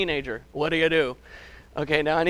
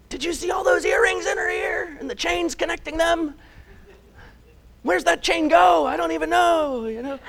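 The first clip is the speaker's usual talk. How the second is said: mock gossip; 10 semitones higher than usual. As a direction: gasp it like a shocked church busybody. Mocking churchgoers' gossip.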